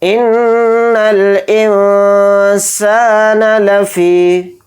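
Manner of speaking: slow, drawn-out tajweed chant; Quran recitation. Shown as a demonstration. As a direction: recite it slowly and melodically. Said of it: "तो ध्यान से देखें".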